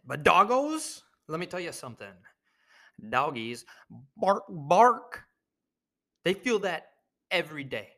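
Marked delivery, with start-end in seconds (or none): 4.2-5.2 barked out loud and high like a dog; comic dog bark; mock bark. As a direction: bark the words like a playful dog. Imitating dogs barking.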